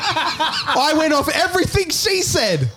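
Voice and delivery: high, slow, through laughter; laughing. Real, not acted. [name] laughs while blaming S1 for the wrong facts. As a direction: laugh it out, complaining.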